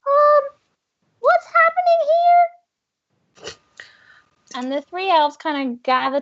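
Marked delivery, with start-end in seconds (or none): 0.1-3.5 very high, squeaky confused elf; a tiny elf puzzled at the mess; bewildered elf. Acted. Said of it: "this elf ... and's like".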